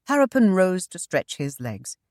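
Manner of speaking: ordinary delivery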